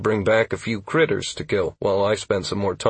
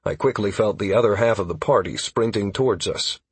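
ordinary delivery, nothing marked

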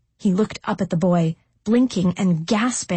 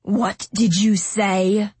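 icy venom. A narrator's reading. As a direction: let each word drip with venom. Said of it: "spoken with icy venom".

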